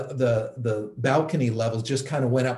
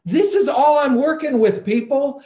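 mock exasperated shout; 10 semitones higher, 7 dB louder than usual. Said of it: "And he starts going".

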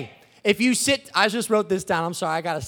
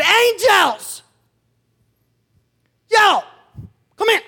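higher, louder summons to the angels; calling out; 9 semitones higher, 5 dB louder, slower than usual. Acted. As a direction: call them over, high and punchy.

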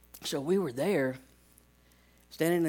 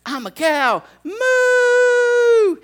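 loud, very high crying moo; big moo; 12 dB louder, much slower than usual. Retold or imitated. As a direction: howl the moo with glee. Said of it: "I'm like This is awesome".

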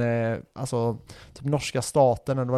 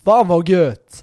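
mock Göteborg exclamation; 6 semitones higher, 9 dB louder, much slower than usual.

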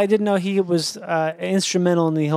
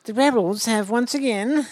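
British aristocrat; 4 semitones higher than usual. Acted. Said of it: "a British aristocrat".

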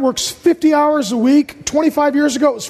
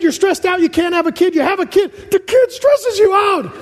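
ordinary delivery

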